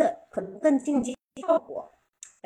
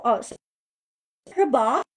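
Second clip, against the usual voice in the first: usual speaking voice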